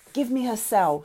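shout. A narrator's reading. Sonia calls to Frank from the deck, mild shout. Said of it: "she shouted".

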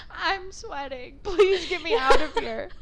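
high, drawn-out whine through laughter; mock plea for help. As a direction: beg to be let out, half laughing. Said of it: "it was like a plea for help".

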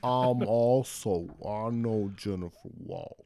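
very slow, low, drawn-out impression; odd-talking coworker. Host imitates the weird dude at the law firm, low and slow. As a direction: stretch it out, low and quiet like a dazed man. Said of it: "he sounds like Will Ferrell".

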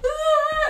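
loud shrill laughing scream of fright; startled yelp; high scream. Retold or imitated. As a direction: let out a sudden scared shriek. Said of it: "and I'm like".

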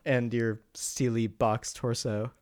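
low, soft, mock-seductive croon. Teasing cohost about the robot, a low soft mock-seductive murmur. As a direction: tease a friend with a hammed-up lovesick murmur. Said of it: "J G goes to bed tonight and he's just like".

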